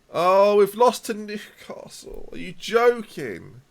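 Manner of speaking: slow, loud, high disbelief; real loud exasperation at the loss; exasperated outcry. Real, not acted. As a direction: drag it out in disgusted disbelief. Conceding to Newcastle.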